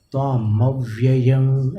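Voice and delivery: त्वामव्ययं with the final anusvara hummed as a nasal "ng"; nasal anusvara ending. Shown as a demonstration. Teacher showing how to carry the anusvara, demonstrating. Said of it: "अनुस्वार को आपको".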